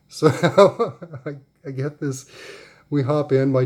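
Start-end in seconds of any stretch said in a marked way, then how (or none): 0.3-1.2 a big real laugh, higher and louder; loud burst of laughter. Real, not acted. Mom laughing at becoming a soccer mom, loud burst of laughter. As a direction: burst out laughing at her own joke.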